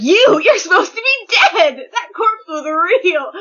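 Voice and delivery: high, loud shock, dragging at the end; incredulous outburst. Acted. Reading out Dabi confronting someone supposed dead, incredulous. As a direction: blurt it in high-pitched disbelief. Acting Dabi's shocked comic line.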